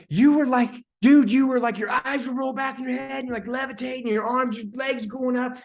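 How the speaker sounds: high-pitched, agitated recounting; retold astonishment. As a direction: open loud, then stay high and incredulous. Reenacts telling mother what she did.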